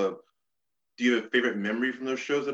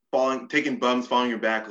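usual speaking voice

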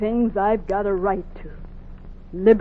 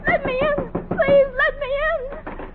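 very high, crying, frantic; desperate plea; slower than usual. Acted. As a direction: beg for your life at the door. Runaway beating on the door.

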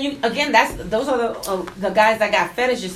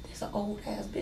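laughing whisper; 16 dB quieter than usual. Retold or imitated. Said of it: "I'm like".

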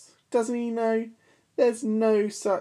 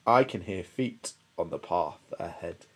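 Gruffalo; 8 semitones lower, 3 dB quieter than usual. Acted. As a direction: rumble it deep and wary. Gruffalo speaks.

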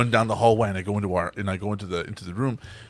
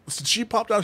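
ordinary talk, nothing marked

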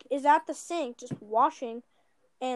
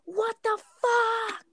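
higher, slow yelled outburst; retold yell; 9 semitones higher, 4 dB louder, much slower than usual. Uncle yelling after the car as they drive away, retold yell. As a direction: hurl it after a departing car. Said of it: "he yells".

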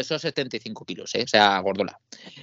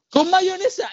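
much higher, loud mocking disbelief; incredulous; 5 dB louder, slower than usual. Mocking the girl's pasta salad recipe, high loud disbelief. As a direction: squeal it in outraged disbelief.

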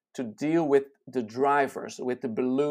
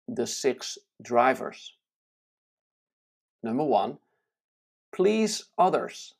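deliberate, widely spaced reading with raised-voice imperatives; slow reading; 4 dB louder than usual. Real, not acted. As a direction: read it slowly, pausing, each driver ringing out like a commandment. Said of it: "I'm gonna read it slowly".

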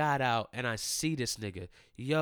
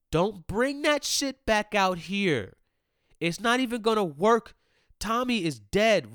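1 louder heated rant; 7 semitones higher, 5 dB louder than usual. Real, not acted. Ranting at the Martin revival.

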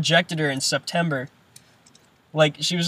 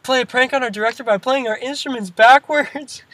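higher, mocking band kid voice; nerdy band kid voice; 7 semitones higher than usual. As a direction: sound like a goofy band kid.

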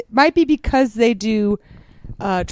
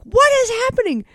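high shocked question; 13 semitones higher, faster than usual. Retold or imitated. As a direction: sound totally baffled. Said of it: "you're like".

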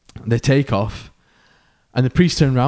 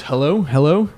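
higher, louder imitated radio call; 5 semitones higher, 5 dB louder than usual. Lawyer on the radio with pilot passed out, calling out. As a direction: act out calling for anyone to answer.

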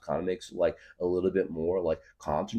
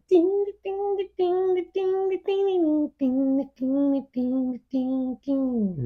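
much higher, slow sung dings; high sung ding guitar imitation; much slower than usual. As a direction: mimic the twangy guitar line.

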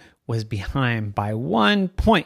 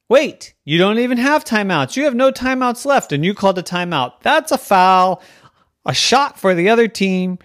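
raised, loud ruling; insistent referee; 7 semitones higher, 7 dB louder than usual. Acted. Referee penalizes a coach with no timeouts, insistent. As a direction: lay down the penalty loudly.

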